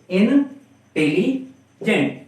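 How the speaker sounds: "इंटेलिजेंट" slowly in three parts, इन-टेली-जेंट; slow, separated इन, टेली, जेंट in "इंटेलिजेंट"; syllable by syllable. Shown as a demonstration. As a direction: break "इंटेलिजेंट" into three chunks with pauses. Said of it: "तीन syllable में हम लोग उसको बोल सकते हैं".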